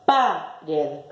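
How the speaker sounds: "Pared" wrongly stressed on first syllable pa. Shown as a demonstration. Teacher testing each syllable, "Pared" stressed on pa. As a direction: hit PA, drop red in "Pared". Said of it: "Vamos a acentuar la primer sílaba".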